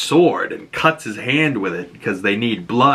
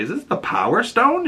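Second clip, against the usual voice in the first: surprised; 11 semitones higher than usual. Retold or imitated. Wondering aloud while watching.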